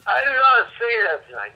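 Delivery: high, loud, insistent protest; real heated outburst; angry denial. Real, not acted.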